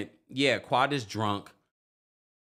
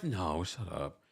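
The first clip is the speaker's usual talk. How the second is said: ordinary talk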